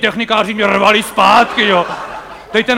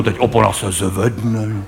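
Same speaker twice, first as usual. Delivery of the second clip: ordinary delivery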